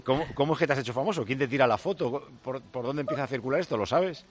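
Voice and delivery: normal talking voice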